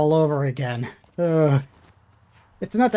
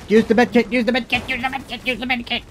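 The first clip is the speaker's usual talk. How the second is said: high, urgent repeated plea; panicked urging; 6 semitones higher, faster than usual. Real, not acted. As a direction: urge them frantically to heal.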